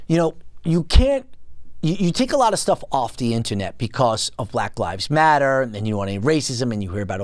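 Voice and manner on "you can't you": usual speaking voice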